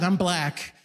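ordinary talk

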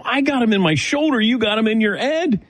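high mock protest; high, teasing back-and-forth. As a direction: pitch it way up in playful disbelief. Retold banter with patient.

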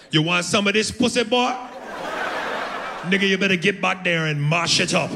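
bossy Jamaican woman pushing him on; Jamaican accent. Retold or imitated. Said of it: "Jamaican broad".

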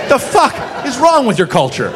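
ordinary delivery

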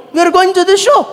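put-on high, emphatic Saudi-accented driver. Driver answering where are we going, in accented English.

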